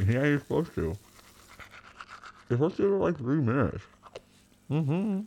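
talking through toothpaste foam; brushing teeth. Real, not acted. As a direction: mouth full of toothpaste. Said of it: "mouthful of toothpaste".

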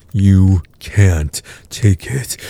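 slow, drawn-out taunt. Acted. Taunting partner that she can't take it, slow. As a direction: stretch each word out, mocking.

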